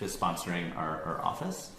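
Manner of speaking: ordinary talk, nothing marked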